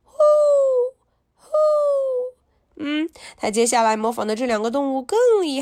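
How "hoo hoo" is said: very high owl hoo; owl hoot. Pretend. Teacher hooting for the class like an owl, very high. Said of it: "He can sound like an owl".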